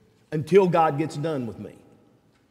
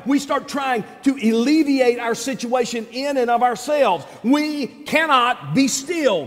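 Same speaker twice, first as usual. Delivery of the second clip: raised, shouting preacher voice; full-force preaching; 4 semitones higher than usual. Acted. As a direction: preach it hard to the congregation.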